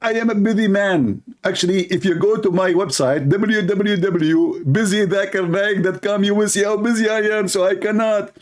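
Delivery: high, smug mock voice; mocking Zakir Naik. Mocking the debater's excuses, high and self-important. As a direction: play the pompous busy man, high.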